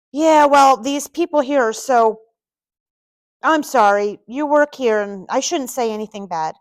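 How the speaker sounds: vent, then catch yourself and apologize; upset venting. A narrator's reading. Upset man to stranger about people here, venting loudly.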